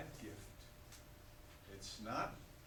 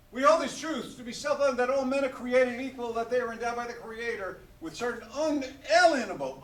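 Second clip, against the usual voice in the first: loud, higher, ringing recital; solemn declaration reading; 10 semitones higher, 13 dB louder than usual. Shown as a demonstration. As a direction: proclaim it like a founding document. Reads the Declaration to kids.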